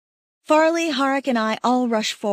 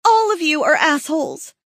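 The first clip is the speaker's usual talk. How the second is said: angry shout; 6 semitones higher, 5 dB louder than usual. Acted. Shouting at the laughing group.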